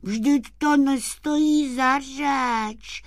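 high, slow, syrupy wheedling; sugary, drawn-out sweet-talk; honeyed voice. Acted. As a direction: coax sweetly in a high sprite voice. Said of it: "řekl medovým hlasem".

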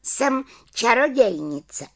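high little witch voice; little witch proudly. A narrator's reading. Little witch tells the children she is a witch, high and proud. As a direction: announce it with pride like a little witch.

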